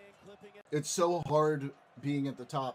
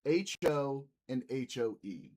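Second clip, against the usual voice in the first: ordinary talk, nothing marked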